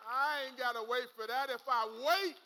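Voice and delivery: loud, driving preacher's voice; full-force preaching. Acted. Pastor hyping up the single women, preached loud. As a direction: lift the church up loudly.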